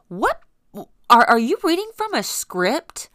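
angry disbelief. Retold or imitated. To the 911 operator reading a script, loud angry disbelief. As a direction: yell at a robotic operator.

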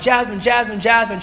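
insistent but controlled name-calling; retold interrupting. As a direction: act out trying to cut into someone's rant. Trying to interrupt his yelling sister.

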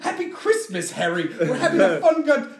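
high, hammed-up English accent; swoopy English-accented wizard impression; mock English accent. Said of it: "he has an English accent".

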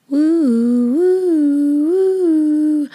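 high, loud siren wail; siren whistle. A narrator's reading. Fire truck racing through town, siren wail. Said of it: "went the whistle".

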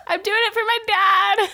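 high, loud comic cry; mock shout. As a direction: shout it out as a joke. Jokingly calls out mid-laughter.

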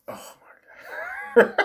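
breathless whispered laugh-talk; helpless whisper dissolving into laughter; overcome with amusement. Retold or imitated. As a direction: gasp it out voiceless, about to crack up.

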